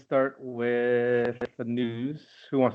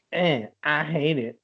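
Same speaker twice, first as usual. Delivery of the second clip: slow, slightly raised imitation of a hater; mock dismissive grumble; 5 semitones higher, slower than usual. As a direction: mimic someone writing it off, drawn out. Mimics fickle people's snap verdicts.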